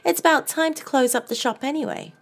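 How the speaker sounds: high, loud sugary Southern lady; Southern belle. A narrator's reading. Southern woman character voice.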